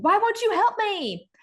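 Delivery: high-pitched retold yell, not very loud. Lily demanding help from Roy, a high mock yell.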